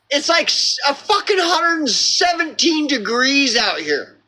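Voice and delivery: high, whining mock of a friend; high mocking whine about the heat; whiny friend. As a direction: mimic the friend's high complaint.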